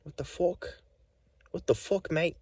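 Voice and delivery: put-on British accent, incredulous and high; British guy. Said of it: "the British guy was like".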